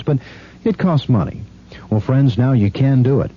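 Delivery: usual speaking voice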